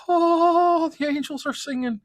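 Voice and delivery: high, rapturous exclamation; swooning with delight; blissful. Retold or imitated. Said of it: "I was like".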